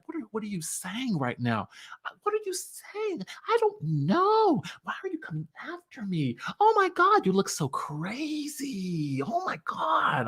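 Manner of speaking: soft, high, condescending put-on innocence; feigned confusion. Imitating Drew acting confused at Sheree, soft and high. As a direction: play dumb in a breathy, whispered, wide-eyed voice. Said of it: "this condescending way ... calm and genteel tone; she acts confused".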